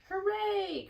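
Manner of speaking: high excited cheer. A narrator's reading. Barn animals cheering Charlotte's plan, high and bright. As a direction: cheer for the saved pig. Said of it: "cried everybody".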